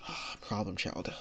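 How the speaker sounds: soft, breathed-out exasperation; weary, hushed sigh of acceptance; resigned sigh. Acted. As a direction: sigh it out quietly, giving in. Teacher gives in to problem student.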